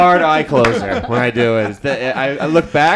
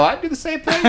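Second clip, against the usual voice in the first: high mock confession. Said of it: "I go".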